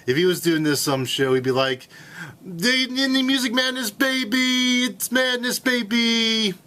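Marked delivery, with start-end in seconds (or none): 2.4-4.8 higher hyped sportscaster shout-out; excited basketball commentator voice; Dick Vitale impression. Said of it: "impersonation of Dick Vitale". 4.8-6.5 higher hyped sportscaster shout-out; excited basketball commentator voice; Dick Vitale impression. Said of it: "that was a good impersonation of Dick Vitale".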